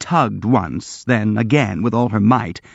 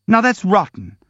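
tearful shout; 10 semitones higher than usual. A narrator's reading. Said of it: "shouted Kolya, fairly weeping".

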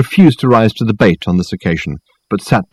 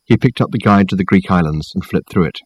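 ordinary delivery

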